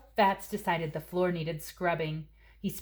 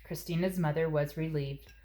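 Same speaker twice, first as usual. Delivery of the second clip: plain reading voice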